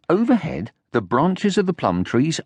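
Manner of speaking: ordinary delivery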